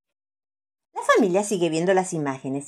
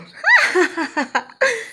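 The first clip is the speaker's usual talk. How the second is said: put-on squealing child laugh; high happy laugh; 4 dB louder than usual. A narrator's reading. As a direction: burst into a giddy laugh. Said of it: "cuando me río".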